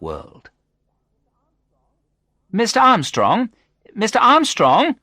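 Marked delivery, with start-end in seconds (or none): 2.5-5.0 high, loud call to a patient. A narrator's reading. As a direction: call the name to rouse him. Someone calling to wake patient.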